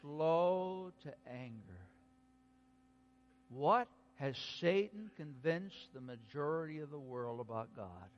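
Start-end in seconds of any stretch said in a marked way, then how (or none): 0.0-1.9 slow, deliberate unison phrase; said together slowly. Shown as a demonstration. Preacher leading the congregation in saying it slowly. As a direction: lead the room through it slowly. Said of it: "Let's say it together".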